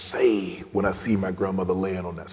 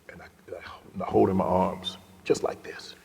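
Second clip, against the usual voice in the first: whispered, barely voiced; hushed whisper; 6 dB quieter than usual. Real, not acted. Telling the host how he held grandmother, whispered. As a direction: drop to a breathy whisper as if at her bedside. Holding grandmother, emotional memory.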